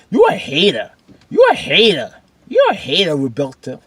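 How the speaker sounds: loud, high playful heckle; mock accusing. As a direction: hurl a teasing accusation at Roberto. Heckles Roberto as a hater.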